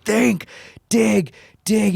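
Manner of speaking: retold psyching-up; acted self-urging. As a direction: push yourself through the strain. Acting urging himself on under strain.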